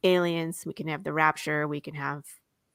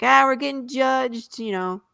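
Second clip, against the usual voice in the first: put-on raised, higher voice of a rambling drunk; drunk ranting; 5 semitones higher, 4 dB louder than usual. Drunk Dwayne to the bar, raving about God's wrath. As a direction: rant like a drunk prophet of doom. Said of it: "he's just spouting nonsense".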